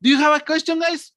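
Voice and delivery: high, loud eager question; hammed-up cheerful asking; happy teacher. Shown as a demonstration. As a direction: call out brightly to the class. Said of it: "from happy".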